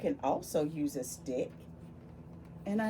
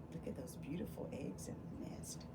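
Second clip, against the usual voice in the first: hushed, fast whisper; 6 semitones lower, 17 dB quieter, faster than usual. Acted. Teacher whispering to children over the picture book. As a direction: whisper it like a secret.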